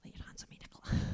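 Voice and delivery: soft whisper to a neighbour; asking Nicola quietly in a whisper to pray; whispered request. Retold or imitated. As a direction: lean over and whisper it. Whispering to friend at conference.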